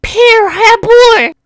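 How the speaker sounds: high, loud slow yell; shout. Acted. Meme insult, yelled high and slow. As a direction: scream it out.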